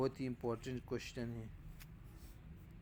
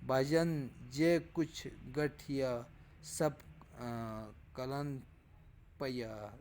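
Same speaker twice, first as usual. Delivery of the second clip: slow, raised, sing-song verse reading; poetry recitation; 2 semitones higher, 4 dB louder, slower than usual. Shown as a demonstration. Teacher reciting Punjabi verse to students, slow and raised. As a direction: chant the old verse slowly and louder.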